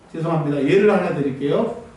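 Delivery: ordinary delivery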